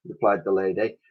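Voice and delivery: plain reading voice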